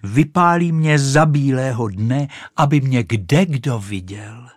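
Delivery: high, loud proud announcement; exclaiming. A narrator's reading. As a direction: proclaim it excitedly. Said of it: "zvolala Raketa".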